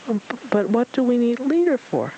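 high, stammering little voice; nervous stammer; timid. Acted. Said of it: "Piglet asked".

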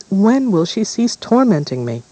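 put-on resentful girl. Bianca complaining to king.

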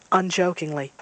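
ordinary delivery

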